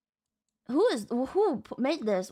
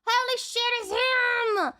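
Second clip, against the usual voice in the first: high thrilled cry; excited recognition; 7 semitones higher, slower than usual. Real, not acted. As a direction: blurt it out with joy. Recognizes a character.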